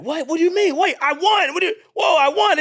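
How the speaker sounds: high, loud, stunned protest; outraged disbelief. Acted. Runner robbed of first place, high outraged disbelief. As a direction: protest the stolen win, voice shooting up.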